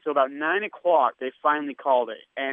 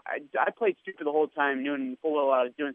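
ordinary delivery

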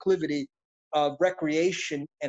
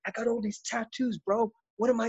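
higher, softer worried protest; re-enacting an unsure plea, pitched up; retold younger self pleading; 4 semitones higher, 4 dB quieter than usual. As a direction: put your doubts to a mentor, high and quick at first. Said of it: "I said to Richard Pryor".

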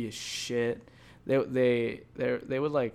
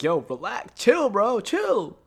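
climbing to a high, loud cry; exasperated plea; 9 semitones higher, 5 dB louder, much slower than usual. Retold or imitated. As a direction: act out begging him to calm down. Retold plea to calm down.

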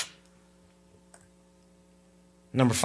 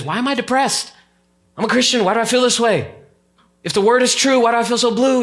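anguished David; 8 semitones higher, 3 dB louder than usual. Retold or imitated. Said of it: "David says".

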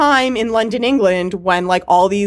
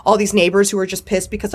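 ordinary talk

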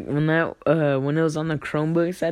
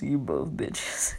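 dad's whisper; 4 semitones lower, 8 dB quieter, slower than usual. Retold or imitated. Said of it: "he goes".

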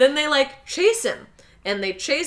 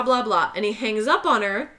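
ordinary talk

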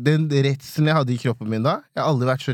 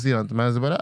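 ordinary talk, nothing marked

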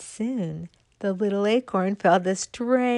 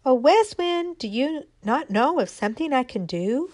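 acorn calling to the wind; 6 semitones higher than usual. Acted. Little acorn calling up to the north wind, pleading. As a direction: call up hopefully to the wind. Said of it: "she asked him".

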